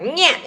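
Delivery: short whiny cat-in-heat noise; nasal cat-like "nyeh". Shown as a demonstration. Mocking sister-in-law's sentence ending, cat-like whine. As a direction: bleat it like a cat's yowl. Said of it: "like a cat in heat".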